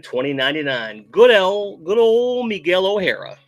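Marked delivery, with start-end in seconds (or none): 1.1-3.4 excited. Acted. Hyping up a new figure.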